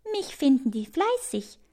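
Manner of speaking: lightly voiced proud ant; grinning pride. A narrator's reading. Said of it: "grinst die Ameise".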